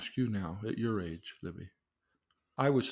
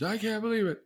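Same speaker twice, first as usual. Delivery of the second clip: wife's exasperation; 9 semitones higher than usual. Retold or imitated. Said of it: "And she's".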